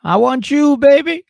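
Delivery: put-on high, loud pleading boyfriend voice; mock lovesick boyfriend. Derek begging Monique to stay, hammed-up plea. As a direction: beg her in a high hammed-up voice. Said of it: "Derek's like".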